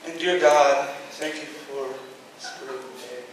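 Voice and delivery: soft, fading prayer whisper; mock sleepy prayer; hushed prayer. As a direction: lull them with a prayer. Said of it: "this is what I would just do".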